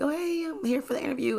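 high-pitched eager applicant hello; smiley greeting. Retold or imitated. Nervous applicant greeting the restaurant staff, smiley and high. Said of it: "smiley, like".

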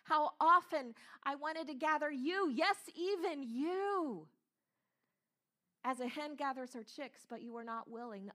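Jesus crying out to the murderers with a broken heart. Acted. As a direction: plead like a heartbroken mother hen. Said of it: "It's the voice of a broken heart".

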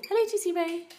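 high chirpy little bird voice, speaking for the bird; pet bird voice. Pretend. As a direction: voice the bird saying hello.

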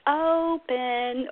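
"open" with a long, lifted O; sing-song exaggerated vowel. Shown as a demonstration. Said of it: "more sing-song kind of prosody".

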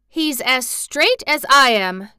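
high, loud, drawn-out declaration; emphatic exultant shout; excited cry. A narrator's reading. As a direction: cry it out proudly. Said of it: "cried Dickon".

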